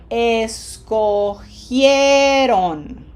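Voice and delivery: Escogieron very slow, syllable by syllable, loud stretched gie; emphatic teacher demo of Escogieron; whole-word demo. Shown as a demonstration. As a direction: draw out Escogieron, with an h sound and both vowels in gie. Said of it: "So the whole word is".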